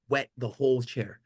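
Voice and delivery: usual speaking voice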